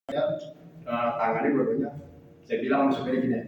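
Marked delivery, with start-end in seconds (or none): none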